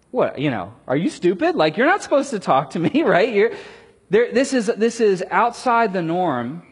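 loud, mocking disbelief with a laugh; incredulous woman. Samaritan woman scoffing at Jesus, loud and laughing. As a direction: scoff at him like he's lost his mind. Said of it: "she looks at him like".

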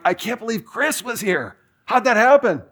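high amazed disbelief; can't believe it; astonished. Retold or imitated. Telling his buddy Brian the news, high and amazed.

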